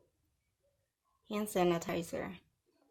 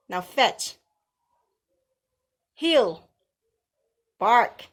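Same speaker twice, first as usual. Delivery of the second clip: much louder, higher barked orders; loud dog commands; 3 semitones higher, 8 dB louder, much slower than usual. Pretend. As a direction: snap each command at the dog. Commanding haters like dogs.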